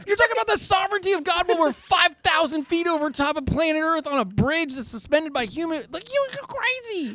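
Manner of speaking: high-pitched, laughing disbelief; squealing exasperation at a crazy remark; incredulous protest. Retold or imitated. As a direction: protest in a hammed-up panic. Retells incredulous protest on the bridge.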